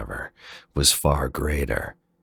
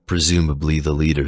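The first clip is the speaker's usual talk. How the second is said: usual speaking voice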